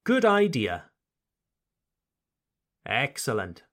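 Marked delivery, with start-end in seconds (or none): 0.0-0.8 Good idea said eye-DEE-uh, clear final A; model for repeating. Shown as a demonstration. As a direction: say Good idea for learners to copy. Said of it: "One more time".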